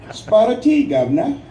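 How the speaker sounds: mock British accent, pitched high and loud; a jokey put-on British accent for a laugh; funny British accent. Joking to veterans about Britain, mock British accent. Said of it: "speaking with a funny accent".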